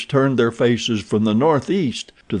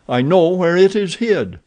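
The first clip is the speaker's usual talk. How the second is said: higher, eager bargaining; eager prisoner; 7 semitones higher than usual. Acted. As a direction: press the deal hard.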